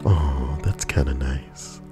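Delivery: plain reading voice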